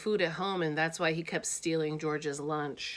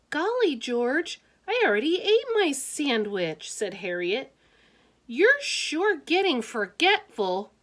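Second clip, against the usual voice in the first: put-on high, louder girl's voice; Harriet's voice; 8 semitones higher, 4 dB louder than usual. Harriet teasing George at lunch, high girlish voice. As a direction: pipe up as a chatty girl.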